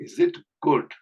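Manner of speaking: "good" begun with a clear hard G; hard G sound. Shown as a demonstration. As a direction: stress the G sound opening "good". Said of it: "கா sound தான் வரணும்". ("good" என்பதில் அழுத்தமான க ஒலி)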